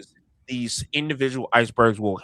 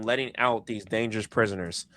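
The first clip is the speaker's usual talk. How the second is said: ordinary delivery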